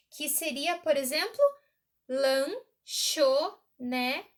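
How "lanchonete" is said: slow, lanchonete said as lan-cho-ne-te; split into syllables. Shown as a demonstration. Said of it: "Quatro aqui". ("lanchonete" bem devagar, lan-cho-ne-te)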